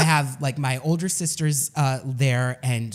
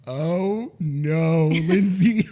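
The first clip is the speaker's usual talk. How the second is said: mock panic; 4 dB louder, much slower than usual. Panicking to friend.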